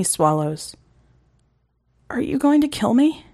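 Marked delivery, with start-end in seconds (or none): 2.1-3.2 higher, scared question; frightened boy. Acted. Eric asking Reagan in fear, higher. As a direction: ask it afraid, higher.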